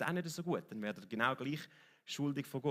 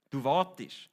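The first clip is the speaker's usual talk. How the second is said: ordinary delivery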